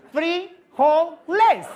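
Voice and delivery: hammed-up gringo pronunciation of "frijoles"; loud phonetics demo. Shown as a demonstration. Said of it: "Pida, por ejemplo". (pronunciación gringa exagerada)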